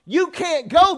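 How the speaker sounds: loud, high preaching cadence, drawn out; full-force preaching. Acted. Preacher hammering at the congregation, loud and high.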